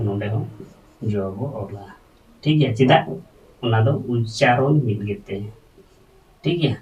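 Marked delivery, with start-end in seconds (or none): none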